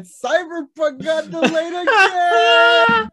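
high, loud, drawn-out yell; hammed-up shouted announcement. Host breaking delay news to his co-host, hammed-up shout. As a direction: shout it out slowly, pitch soaring.

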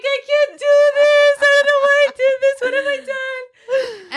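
high, loud, half-laughing despair; retold panic. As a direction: wail it high like a panicking mom-to-be. Retelling her panic attack.

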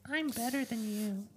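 high, slow mocking whine. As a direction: echo it in a whiny high voice. Friend echoes the mock boast.

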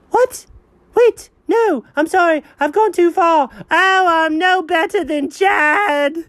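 high, hammed-up remorse; melodramatic dog voice. Dog apologizing to the cat, high and dramatic. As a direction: overplay the guilt in a high pet voice.

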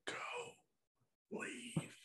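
barely-there whisper; silent whisper. Acted. Sister secretly warning her rescuer, whispered. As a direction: mouth it with almost no voice, word by word. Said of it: "silently says".

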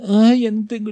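high, put-on whine of the teacher; a hammed-up whiny teacher; mock whiny teacher. Teacher voiced whining about markers.

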